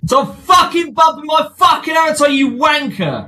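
high, furious shout; angry shout. Real, not acted. A player screaming at the troll in real anger. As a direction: scream the insult at him.

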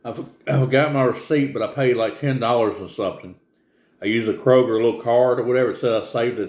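chewing while talking; talking between bites of pizza; mouth full of food. Real, not acted. Seen 1: Holds pizza slice at mouth, chewing, wipes mouth with napkin.

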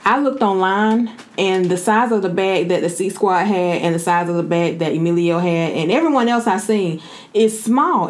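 ordinary talk

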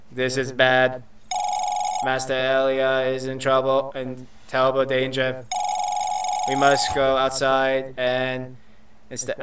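monotone robot raising an alarm. Acted. Robot warning that its master is in danger, monotone robot voice. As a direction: keep every word on one robotic note. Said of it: "robot voice is making my throat hurt".

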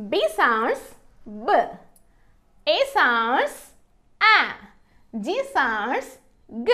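"B", "A", "G" as short isolated sounds; swooping "sounds", then a short phonic after a pause; phonics sounding-out. Shown as a demonstration. Teacher sounding out B, A, G for learners, each sound short and separate. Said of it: "ఎలా పలుకుతుందో చూద్దాము".

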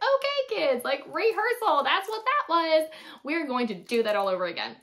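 loud, high put-on classroom voice; rally the kids, covering up the redo; put-on teacher cheer. Teacher telling kids the lesson was only rehearsal, put-on cheer.

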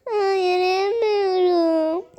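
put-on slow, whimpering hurt child; pretend crying. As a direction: whine it like a hurt little girl. Doll child hurt, can't walk. (yavaş, ağlamaklı çocuk sesi)

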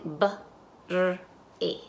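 "b, r, e" as isolated sounds, r rolled alone. Shown as a demonstration. Teacher segments bre into phonemes, sound by sound. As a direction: sound out "b, r, e" one at a time. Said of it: "y ahora dicen".

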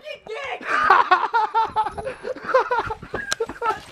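high shouted laughter. Real, not acted. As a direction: burst out laughing at the prank. Prank reveal, laughing.

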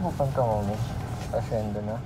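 muffled behind mask and shield; covered mouth speech; through a face mask. Real, not acted. Seen 5: Surgical mask and clear face shield worn throughout; references unmasked.

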